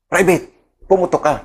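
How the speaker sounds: team leader snapping a sharp, high order; barked order. Retold or imitated. Team leader orders a frozen private to fire, barked. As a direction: bark it like a squad leader under fire. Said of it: "sabi niya sa akin".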